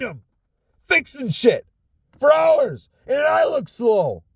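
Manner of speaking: high-pitched, worked-up complaint; really fed-up, high-pitched griping; exasperated rant. Real, not acted. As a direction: vent your frustration, pitch climbing high. Venting about careless coworkers at work.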